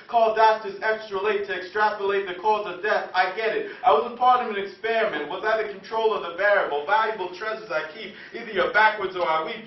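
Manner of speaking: impassioned slam poetry delivery, projected; poem performed out loud to an audience; spoken-word poem. Acted. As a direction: recite it with rhythmic, insistent drive.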